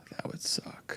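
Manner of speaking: hushed under the breath; whispering. Real, not acted. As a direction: drop to a whisper. Reacting to a gruesome injury.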